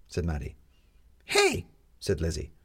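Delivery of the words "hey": high, loud protesting yelp; child's offended cry; indignant shout. A narrator's reading. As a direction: object sharply. Lizzie protests.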